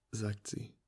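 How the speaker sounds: plain reading voice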